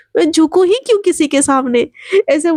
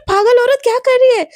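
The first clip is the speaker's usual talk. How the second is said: shocked outcry; 9 semitones higher than usual. Acted. Slapped boy cries out.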